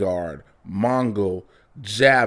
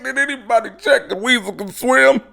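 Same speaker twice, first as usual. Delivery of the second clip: higher, louder, alarmed; higher alarmed shout to the squad; Rick Flag voice; 11 semitones higher, 5 dB louder than usual. Retold or imitated. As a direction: call it out like a startled squad leader. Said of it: "you hear Rick Flag".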